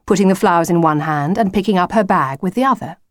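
plain reading voice

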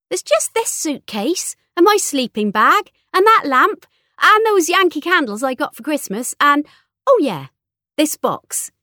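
put-on high, loud, girlish list; breezy teenage daughter. A narrator's reading. Daughter listing what's left to pack, high breezy voice.

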